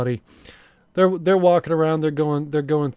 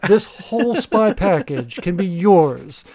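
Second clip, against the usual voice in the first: home shopping host; 2 semitones higher, 3 dB louder than usual. Pretend. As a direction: sell it like a late-night infomercial. Mock infomercial Gadget Time pitch.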